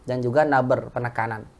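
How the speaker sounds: ordinary talk, nothing marked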